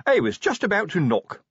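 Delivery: high-pitched, chirpy sergeant; cheerful. A narrator's reading. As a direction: say it cheerfully to the boss. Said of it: "said Sergeant Colon cheerfully".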